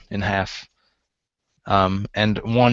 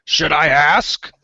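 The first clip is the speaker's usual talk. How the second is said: higher, drawn-out character voice; simple fellow asking, pitched up; slow-witted helper character; 4 semitones higher, 4 dB louder, slower than usual. Acted. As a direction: ask slowly, with a raised voice. Same character asks what to do.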